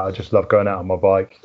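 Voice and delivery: ordinary delivery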